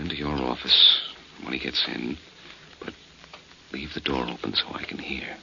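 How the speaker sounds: urgent whispered instruction. Acted. Blackie whispering orders to the dentist before the suspect arrives. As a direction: whisper it, the killer is near. Hiding, suspect coming down hall.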